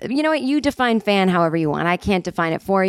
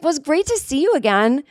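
high-pitched polite sign-off, re-enacted; awkward pleasantry; 8 semitones higher than usual. Snubbed customer to the waitress, high awkward pleasantry. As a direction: keep it friendly though snubbed, voice up high.

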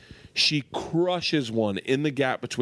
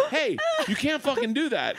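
higher, scolding through laughter; laughing scold; 12 semitones higher than usual. Retold or imitated. Dad scolding daughter over the car alarm, laughing and higher. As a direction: scold her but can't stop laughing.

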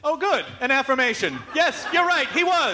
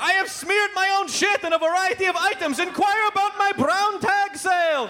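much higher hammed-up TV pitchman voice; Crazy Gideon; 10 semitones higher than usual. Comedian imagining the ad, high manic pitchman. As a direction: sell it like an unhinged late-night huckster. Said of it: "come out and go".

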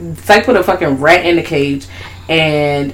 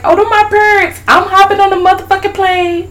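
very high eager declaration; high excited doll voice. Retold or imitated. Friend retelling the movie, the kid deciding to find its parents, high eager. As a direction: chirp it up high, all eager.

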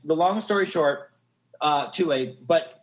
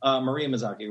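ordinary talk, nothing marked

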